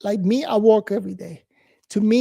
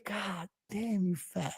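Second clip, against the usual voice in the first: much softer, under the breath; muttered insult; 2 semitones lower, 14 dB quieter, slower than usual. Retold or imitated. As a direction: say it so the boss won't hear. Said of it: "that you were saying".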